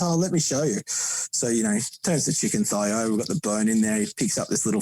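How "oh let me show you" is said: higher, eager offer to demonstrate; a hint of an eager mentor voice; helpful friend quote. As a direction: lightly play the mate showing how. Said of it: "He goes".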